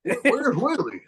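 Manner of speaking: very high, hammed-up country voice; mock country accent. Co-host echoing the townspeople's goat hunt, mock country accent. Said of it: "I don't know why I'm doing that voice".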